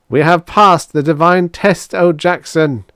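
loud, high triumphant proclamation; jubilant shout. A narrator's reading. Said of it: "two oh four shouted up jubilantly".

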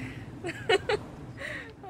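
high giggle of uncertainty; nervous laugh. Real, not acted. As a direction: laugh off a possible mistake. Laughs after possible mistake.

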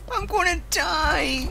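high, drawn-out mock wail; playful high-pitched cry of doom; dying wail. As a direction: wail slowly that you're about to die. Crying out while dying in game.

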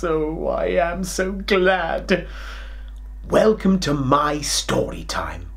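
plain reading voice

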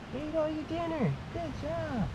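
tiny high voice, gently proud; cooing praise. Real, not acted. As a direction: praise a little pet sweetly. Praising the baby goat.

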